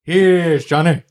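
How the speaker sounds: slow, drawn-out menacing "Here's Johnny"; Jack Nicholson Shining line. Retold or imitated. Jim Carrey voicing The Shining line, slow and menacing. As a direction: stretch it out like the axe scene. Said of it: "how he might say it if he was in The Shining".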